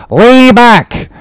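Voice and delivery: very high, drawn-out order; calling to crew. A narrator's reading. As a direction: holler it to your oarsmen. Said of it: "addressing his crew".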